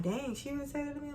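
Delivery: soft, high imagined reaction; shocked coworker. Retold or imitated. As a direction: voice her silent shock softly and high. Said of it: "she was just looking like".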